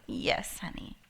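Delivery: low whispered husband's reply; hushed, barely voiced answer; mild husband whisper; flat whispered reply. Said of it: "He goes".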